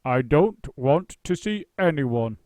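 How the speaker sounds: slow, loud, booming actor's voice. A narrator's reading. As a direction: boom it out from the couch. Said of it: "said a booming voice".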